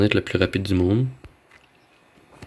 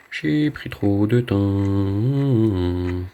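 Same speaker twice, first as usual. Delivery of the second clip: very slow drawl; much slower than usual. Shown as a demonstration. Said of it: "stretched out with music".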